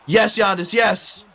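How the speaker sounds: loud, high shout of joy; celebrating a clutch play; cheering yes. Real, not acted. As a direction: yell it as the shot drops. Giannis scores late.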